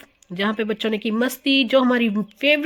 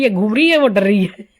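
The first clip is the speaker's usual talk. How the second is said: loud, laughing through the words; cracking up at the ride; real giggle while describing; 7 dB louder than usual. Real, not acted. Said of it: "मेरे को इतनी हंसी आ रही थी ना".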